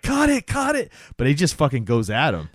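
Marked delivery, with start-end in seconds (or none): 0.0-0.9 much higher urgent yell to stop; panicked order to cut the feed; frantic call. Retold or imitated. TV crew calling to stop the live broadcast, frantic. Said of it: "And they're like".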